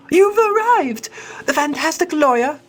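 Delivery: very high, thrilled little lady; giddy high cat lady; excited girlish voice. Acted. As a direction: squeal the greeting, pitched far up.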